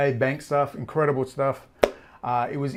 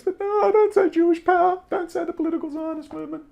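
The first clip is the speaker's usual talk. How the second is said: much higher, sneering mock warning; 3 dB louder than usual. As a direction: parody their timid rules. Mocking their forbidden topics.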